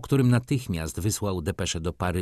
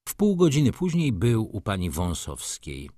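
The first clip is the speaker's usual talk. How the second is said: usual speaking voice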